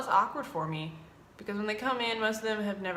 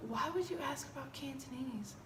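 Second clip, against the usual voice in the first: higher and much softer, bemused; puzzled aside; 5 semitones higher, 9 dB quieter than usual. Retold or imitated. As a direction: wonder it half to yourself. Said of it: "I'm like".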